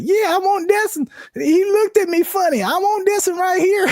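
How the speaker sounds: put-on very high, squeaky little girl voice, laughing; Honey Boo Boo. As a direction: squeal it like a bratty country kid. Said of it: "Honey Boo Boo".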